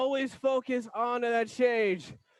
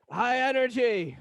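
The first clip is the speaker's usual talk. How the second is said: ordinary talk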